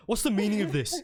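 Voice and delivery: hammed-up high, loud demand; mock the outraged officer's voice; accented sergeant. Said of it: "I like how I gave him".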